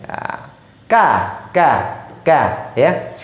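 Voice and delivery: "ka" kept thin with the tongue low. Shown as a demonstration. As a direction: say ka light, never as a full "ko". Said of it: "dia tetap tipis".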